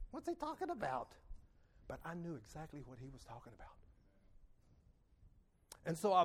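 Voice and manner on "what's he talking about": high, soft puzzled question; puzzled squeak. Retold or imitated. Listener confused by the Spirit, high puzzled voice. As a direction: ask it high and baffled. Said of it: "you may sort of think like".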